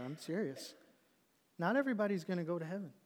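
usual speaking voice

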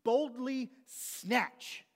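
snatch the words out forcefully; bold emphasis. Shown as a demonstration. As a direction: act out a bold, aggressive grab in the voice. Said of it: "it means aggressively".

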